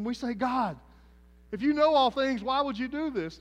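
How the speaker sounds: fiery revival preaching; preaching at full force. Acted. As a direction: preach it hard to the congregation.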